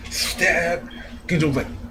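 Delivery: ordinary delivery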